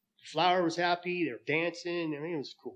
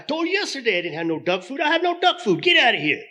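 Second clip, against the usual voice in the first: angry bartender; 10 semitones higher, 7 dB louder than usual. Acted. Bartender loses patience with duck.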